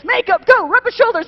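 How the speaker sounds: loud, high rallying commands; mock emergency orders. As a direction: fire off orders like a comic rescue team. Mock emergency orders among ladies.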